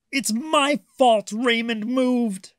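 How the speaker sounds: put-on high, slow, cross child voice; snapping. A narrator's reading. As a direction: snap it out, upset and blaming himself. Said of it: "Gilbert snapped".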